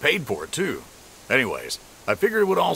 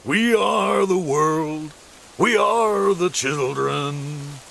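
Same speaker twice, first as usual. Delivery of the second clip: [name] impression; 3 semitones higher, much slower than usual. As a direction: croon it slowly like Bruce. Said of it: "I can do Bruce".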